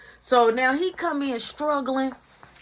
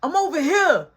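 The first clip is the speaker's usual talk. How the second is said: high, loud call across the street; 7 semitones higher, 5 dB louder than usual. Retold or imitated. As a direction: call out to get noticed. Friends calling out to him.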